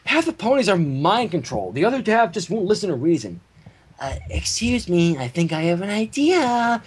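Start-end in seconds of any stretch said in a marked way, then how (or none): 4.0-6.8 Fluttershy voice. Acted. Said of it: "in a reedy, littling sing-song afflicted with a curious lisp".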